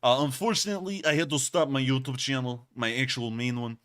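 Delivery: put-on Russian accent in the style of a YouTuber; hammy, imperfect Russian accent; terrible Russian accent. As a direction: do a badly faked Russian accent. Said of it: "I'm doing a terrible one now".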